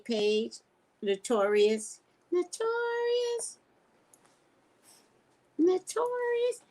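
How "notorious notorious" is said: sing-song name. Acted. Drawn out greeting name.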